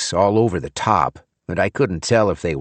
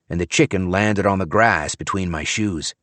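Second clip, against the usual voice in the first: ordinary delivery, nothing marked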